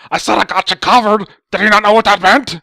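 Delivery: high-pitched furious mock shouting; hammed-up screaming tantrum; freaking out. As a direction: imitate a raging man-baby yelling. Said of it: "he starts freaking out".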